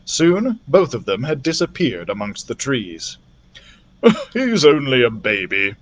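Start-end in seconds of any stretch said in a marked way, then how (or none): 4.0-5.7 fond laugh. Acted. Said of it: "said Doctor Drake with a smile".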